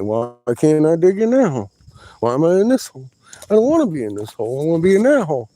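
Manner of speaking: loud, high-pitched mock protest; replayed aggravated whining, high and loud; aggravated complaint. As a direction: act out an exasperated tantrum at being stuck. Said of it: "I was so aggravated this morning".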